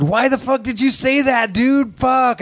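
high-pitched retold freak-out. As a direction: act out startled disbelief, pitch shooting up. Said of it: "I was acting really freaked out".

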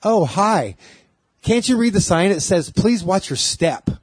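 high, sassy drag queen voice; sing-song sassy put-down. Retold or imitated. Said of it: "talking like a sassy ass".

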